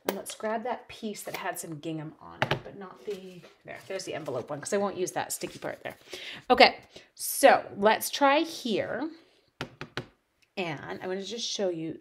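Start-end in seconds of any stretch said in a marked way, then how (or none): none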